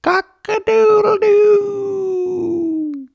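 very high, slow, shouted cock-a-doodle-doo; rooster crowing loudly. A narrator's reading. Rooster straining to wake the farmer, loud crowing. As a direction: crow with all your might like a rooster. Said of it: "the rooster crowed".

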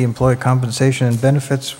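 normal talking voice

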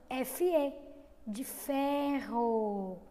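de ferro very slowly; slow demo. Shown as a demonstration. Teacher showing the fe in ferro slowly. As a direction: stretch ferro out syllable by syllable. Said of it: "preste atenção".

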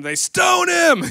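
high, loud, slow mock cry; hammed-up yell of a mob; mock shout. Pastor imagines the crowd turning on him, mock shout.

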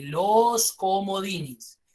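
very slow, long held vowels; drawn-out emphasis. Real, not acted. Teacher repeating the student's right answer, slow drawn-out emphasis. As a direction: stretch out "los comodines" syllable by syllable.

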